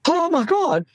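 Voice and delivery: high, alarmed "Oh my God," like a frightened patient; panicked cry. Retold or imitated. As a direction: imitate a panicking patient bursting in. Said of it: "somebody comes running into the casualty saying".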